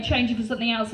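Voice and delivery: normal talking voice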